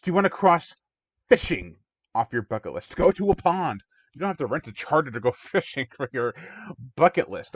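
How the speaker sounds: loud, high-pitched scoffing; incredulous retort. Real, not acted. Reviewer scoffing at fishing as a bucket-list wish, incredulous. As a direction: challenge them in exasperated disbelief.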